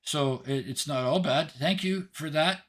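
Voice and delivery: put-on high, loud little-girl call; tiny girl yelling out. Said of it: "and she yells out".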